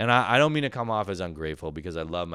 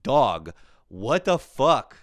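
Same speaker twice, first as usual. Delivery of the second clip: slow exasperated outburst; 3 semitones higher, 6 dB louder, much slower than usual. Real, not acted. Exasperated punchline at dad.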